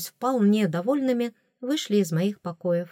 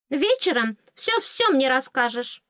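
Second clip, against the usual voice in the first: higher fairy voice, lightly bossy; commanding fairy; 6 semitones higher than usual. A narrator's reading. Fairy demands evening report, high command. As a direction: demand it in a raised little voice. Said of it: "приказала оскалка".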